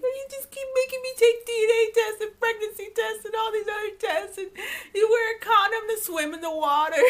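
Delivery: high, loud whiny sobbing imitation; hammed-up wailing through the words; mock crying. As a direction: imitate a tearful complaint, way up in pitch. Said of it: "crying, like".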